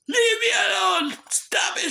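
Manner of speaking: very high, loud outraged shout; yelling. Retold or imitated. Man pushed past his limit by accusers, yelling. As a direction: scream it at someone who won't back off. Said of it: "I was yelling and everything".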